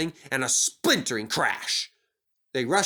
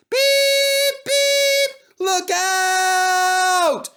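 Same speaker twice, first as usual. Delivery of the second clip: much higher, louder warning shout; warning whistle; 8 dB louder, much slower than usual. Acted. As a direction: warn everyone out of the way. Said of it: "he whistled".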